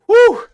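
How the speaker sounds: high, loud whew; out-of-breath whew. Real, not acted. Streamer winded after packing merch orders, out-of-breath whew. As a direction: blow out a sharp, high whew. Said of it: "I'm a little out of breath".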